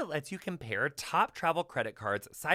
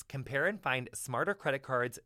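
ordinary delivery

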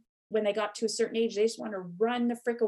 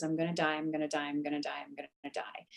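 repeating it lower and quieter each time, mild retold fear; sinking into a frightened whisper; fading panicked mantra; 5 semitones lower, 7 dB quieter, faster than usual.

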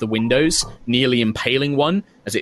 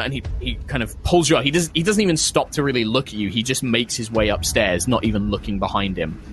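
normal talking voice

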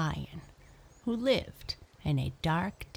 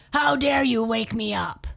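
loud, higher-pitched lion's roar; angry roar; 5 semitones higher, 9 dB louder than usual. A narrator's reading. Lion to the mouse who woke him, roaring angrily. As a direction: roar it in outrage at being woken. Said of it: "Lion roared angrily".

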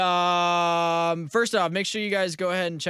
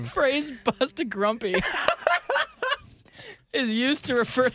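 laughing; 10 semitones higher than usual. Real, not acted. Reading while giggling.

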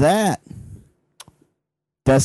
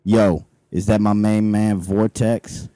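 low street guard voice; 6 semitones lower, slower than usual. Acted. Guard greets Vortex.

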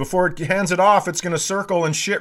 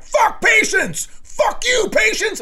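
slow furious scream, far higher and louder; 5 dB louder than usual. Retold or imitated. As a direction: blow up and scream it out. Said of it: "I'm, like, screaming".

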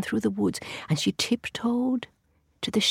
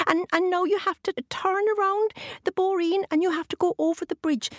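leprechaun; 11 semitones higher than usual. A narrator's reading. Leprechaun gives directions.

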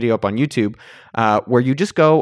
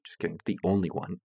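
whispered aside; 6 semitones lower, 11 dB quieter than usual. Acted. Aside after joke.

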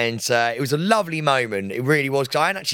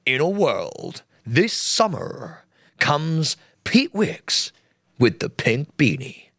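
slow, dramatic trailer announcer; movie trailer voice; much slower than usual. Shown as a demonstration. Host hams up a slow film trailer about Pete. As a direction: draw it out like an epic film trailer. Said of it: "we make trailers".

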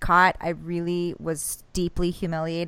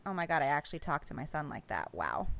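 lower, softer ashamed self-talk; ashamed inner voice; 6 semitones lower, 11 dB quieter than usual. Retold or imitated. Mom ashamed of how she spoke, quiet. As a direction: mutter it to yourself in shame. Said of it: "I just felt like a piece of shit".

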